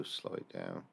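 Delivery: usual speaking voice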